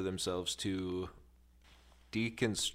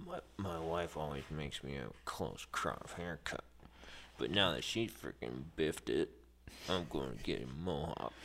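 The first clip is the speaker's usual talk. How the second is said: low Hawkeye voice; 5 semitones lower, 6 dB quieter than usual. Pretend. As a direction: lower gruff widower voice.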